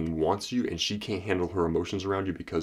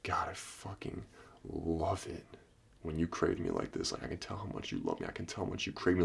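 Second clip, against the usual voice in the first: seductive murmur; 9 dB quieter than usual. Shown as a demonstration. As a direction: murmur it like you're pleased she wants you. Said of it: "you gotta be like".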